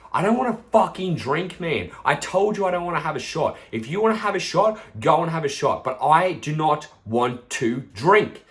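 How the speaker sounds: quite stern. Retold or imitated. Telling a pushy friend no on his birthday, sternly. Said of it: "I said it quite sternly".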